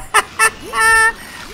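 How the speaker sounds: high-pitched giggle; squeaky laugh. Real, not acted. Laughs at giant shark.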